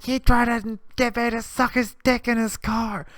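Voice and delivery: high, put-on drunk girl voice. Drunk girl telling the narrator about the guy, high put-on voice. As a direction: play the wasted girl accusing him. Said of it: "And she's like".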